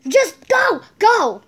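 loud, sharp call to go; shouting. Real, not acted. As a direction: yell it out, high and loud. Shouting brother away from camera.

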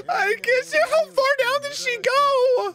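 very high squeal through laughter; laughing hard. Real, not acted. Helpless laughter at the fall.

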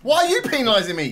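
high, loud indignant complaint; loud protest. Real, not acted. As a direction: yell it as a playful protest. Protesting a penalty in a quiz.

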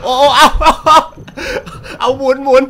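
high, loud whoops broken by laughter; excited shouting. Real, not acted. Gamer watching his sniper kill, shouting excitedly and laughing. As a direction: yell at the screen, cracking up.

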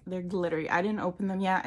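usual speaking voice

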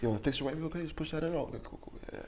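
hushed whisper, barely voiced. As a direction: whisper so the camera can't hear. Whispering to artist mid-show.

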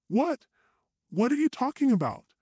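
high, incredulous outburst; can't believe what she's hearing; sharp rising "what?" then high disbelief; exclaimed in disbelief. A narrator's reading. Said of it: "I exclaimed in disbelief".

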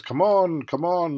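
lightly acted annoyance; annoyed teacher. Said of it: "The teacher gets annoyed and says".

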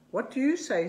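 playful question to the frog; sing-song question. Acted. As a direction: coax the frog to answer. Asking the frog.

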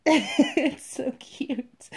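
slow, laughing gush. Real, not acted. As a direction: laugh through the words, drawn out. Laughing over the doll's butt.

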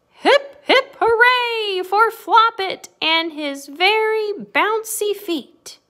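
high, slow, loud hooray; big storybook cheer. A narrator's reading. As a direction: cheer the hero, stretching the words. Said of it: "all gave a great big cheer".

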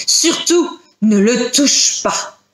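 slow, emphatic insistence; insistent, drawn-out prohibition; urgent warning. Acted. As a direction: press the warning home slowly. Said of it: "d'une voix pressante".

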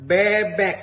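nose held shut; pinching nose. Acted. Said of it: "Tutup hidung".

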